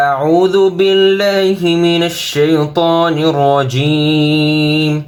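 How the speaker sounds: slow, melodic tajweed chant with long drawn vowels; Quran recitation. Acted. Teacher reads the whole surah to students, melodic chant.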